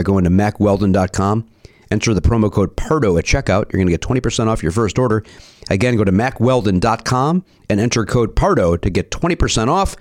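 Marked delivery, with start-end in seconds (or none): none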